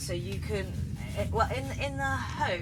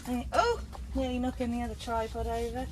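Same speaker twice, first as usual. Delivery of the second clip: ordinary delivery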